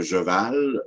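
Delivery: "cheval" said as "jeval"; CH becomes J. Shown as a demonstration. Lecturer giving example, "cheval" as "jeval". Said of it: "le ch, devient un J".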